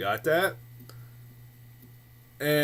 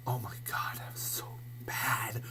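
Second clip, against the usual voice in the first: soft whisper of disgust; whispered aside; 4 semitones lower, 12 dB quieter than usual. Real, not acted. Telling viewers how bad the trailer was, whispered. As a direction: drop to a whisper.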